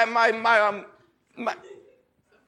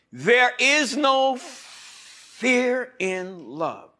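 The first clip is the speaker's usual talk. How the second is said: slow, measured reading with lifted phrases; solemn Bible reading from the pulpit; scripture reading; 4 semitones higher, 6 dB louder, slower than usual. Acted. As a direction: read it out like holy writ. Reads scripture aloud.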